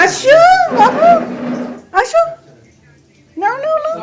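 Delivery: high, sweet coaxing voice. Real, not acted. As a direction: call the little one over sweetly. Coaxing a small child over.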